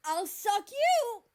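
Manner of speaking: very high, very loud, slow squeal; slow shrieked comeback. Acted. Mexico blurting a wild comeback at America, shrieked slow. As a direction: stretch the threat into a shrill yell.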